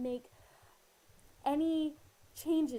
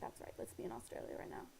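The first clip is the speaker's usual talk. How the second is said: whispered reassurance, close to the ear; a soft comforting whisper while hugging; soothing whisper; 11 dB quieter, faster than usual. Retold or imitated. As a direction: hush it gently like calming a friend. Pete hugging her, calming her.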